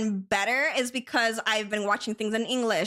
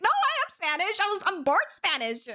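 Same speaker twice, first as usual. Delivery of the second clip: high, laughing indignant denial; mock-offended, giggling insistence; laughing protest; 8 semitones higher than usual. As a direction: protest with a laugh that you are Spanish. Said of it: "I was like".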